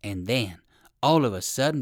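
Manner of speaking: ordinary delivery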